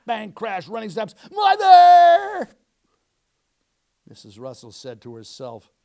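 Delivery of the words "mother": very high, loud wailing call; crying shout. Acted. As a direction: shriek for mother in tears. Child runs crying to mother.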